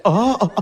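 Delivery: hammed-up cartoon intake of shock; anime gasp. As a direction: gasp like a startled anime character. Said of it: "I even hit that anime gas".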